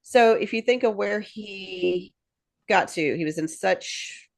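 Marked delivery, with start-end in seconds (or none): none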